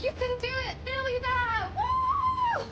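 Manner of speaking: high pitched cheer, nearly there; high excited cheering. Acted. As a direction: squeal encouragement up high. Cheering finish.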